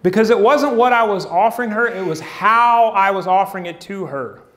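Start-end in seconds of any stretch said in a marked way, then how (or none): none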